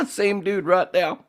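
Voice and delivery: slow, high words squeezed through laughter; laughing. Real, not acted. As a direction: talk through the tail of a big laugh. Cracking up at a picture.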